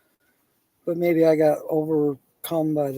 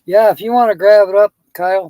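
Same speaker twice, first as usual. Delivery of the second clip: louder, calling across the room; 3 semitones higher, 9 dB louder than usual. Real, not acted. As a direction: raise the voice to reach Kyle. Calling over to Kyle in studio.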